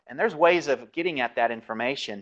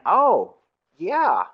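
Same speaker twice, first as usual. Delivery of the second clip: higher, louder exclamation as the author; Chronicles author; 7 semitones higher, 6 dB louder than usual. Retold or imitated. Teacher voices the Chronicles author having an idea, emphatic exclamation. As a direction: voice the ancient writer's sudden realization, pitched up. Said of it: "And he's saying".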